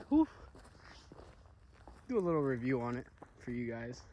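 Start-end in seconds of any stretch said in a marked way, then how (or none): 0.1-0.3 tired exhale. Real, not acted. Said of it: "Sorry, I'm a little tired".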